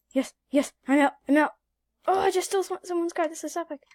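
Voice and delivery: normal talking voice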